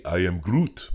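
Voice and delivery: a hint of Groot; Groot line. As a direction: say it as the tree Groot. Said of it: "I didn't get to say".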